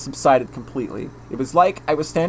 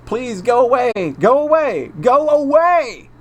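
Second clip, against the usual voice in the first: building from a plea to a high, loud scream; panicked yell; 9 semitones higher, 3 dB louder than usual. Acted. Keiichi screaming at Rena to leave, building panicked yell. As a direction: rise higher and louder each time until it breaks, slowing on the last.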